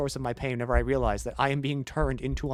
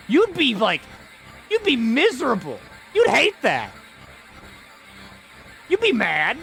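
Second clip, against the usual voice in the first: high, louder indignant outburst; worked-up indignation; 10 semitones higher, 4 dB louder than usual. Real, not acted. Arguing the mouse's side, high and indignant. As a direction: get riled up on the mouse's behalf. Imagining a giant picking you up.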